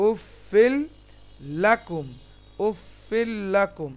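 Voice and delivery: slow "উফিল লাকুম" with a held l; doubled l. Shown as a demonstration. Teacher repeating the merged word for students, doubled l. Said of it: "তাসদিদের সঙ্গে মিলছে এখানে".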